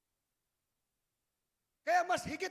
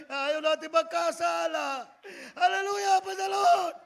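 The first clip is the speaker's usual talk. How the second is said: shouted praise; 2 semitones higher, 10 dB louder, slower than usual. Acted. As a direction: end the point with a shout of praise. (sigaw ng papuri, mataas at malakas)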